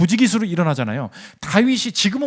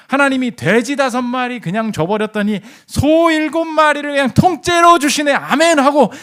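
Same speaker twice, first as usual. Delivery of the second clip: high, loud, jubilant testimony; acted exultation; 8 semitones higher, 4 dB louder than usual. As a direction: build to a triumphant amen. Acts out rejoicing at seven cows.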